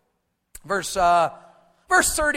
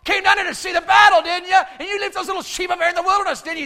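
high, loud big-brother taunting; mocking; 10 semitones higher, 11 dB louder than usual. Angry eldest brother to little David, loud mockery. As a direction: jeer at him, making fun. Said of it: "He's making fun of the sheep".

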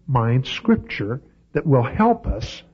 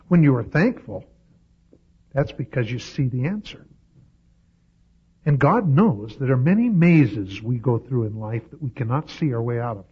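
usual speaking voice

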